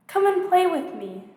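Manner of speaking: lightly voiced young prince; little prince voice. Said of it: "proposed the little prince".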